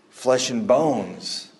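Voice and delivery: Flesh and bones spoken very slowly, each word weighed; slow emphasis. Shown as a demonstration. Preacher stressing Jesus' words, "Flesh and bones." very slow. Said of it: "And here he says".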